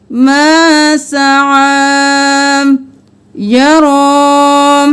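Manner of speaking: سعى and یرا chanted very slowly, ending on an open a; stop without ya. Shown as a demonstration. Teacher demonstrating the stop, slow chanted recitation. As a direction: stretch سعى and یرا and drop the ya. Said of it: "یہاں پر بھی یا کو نہیں پڑھیں گے".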